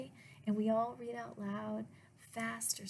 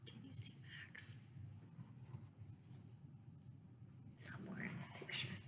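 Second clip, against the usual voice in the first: put-on soft whispered question to kids; hushed question; 10 dB quieter, faster than usual. A narrator's reading. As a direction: invite them to look, whispering. Asks children to find Max.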